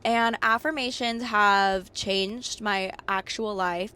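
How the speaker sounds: ordinary talk, nothing marked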